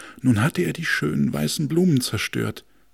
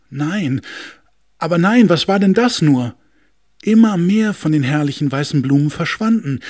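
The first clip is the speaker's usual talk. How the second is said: high, loud dismay; shocked; 6 semitones higher, 7 dB louder than usual. A narrator's reading. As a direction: cry out in disbelief. Furniture shocked by vanishing flowers.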